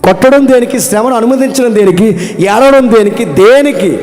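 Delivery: loud, high pulpit questions; fervent preacher building to a peak; preaching at full force. Acted. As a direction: hammer each why at the congregation. Preacher repeats questions climactically.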